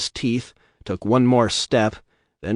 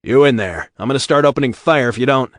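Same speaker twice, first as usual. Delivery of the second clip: shouted warning; 3 semitones higher, 6 dB louder, faster than usual. Acted. Calling out into the dark.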